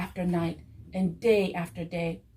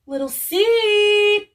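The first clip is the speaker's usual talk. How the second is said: high, loud, slow drawn-out shout; 12 semitones higher, 11 dB louder, much slower than usual. A narrator's reading. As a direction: yell the name across the farm. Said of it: "he shouted".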